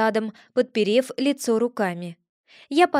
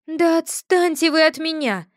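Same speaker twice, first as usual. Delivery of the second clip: irritated outburst; 6 semitones higher, 4 dB louder than usual. A narrator's reading. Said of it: "дёрнулась я".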